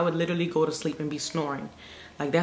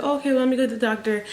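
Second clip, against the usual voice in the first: higher-pitched, quick reply; quick, high mock-agreeable voice; imitated nurse; 6 semitones higher, much faster than usual. Acting the nurse's hurried reply.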